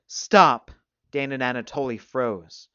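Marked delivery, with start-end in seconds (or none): 0.1-0.7 higher, forceful command; loud protest. A narrator's reading. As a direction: cut through the chanting loudly. Taunted boy cuts off the chant.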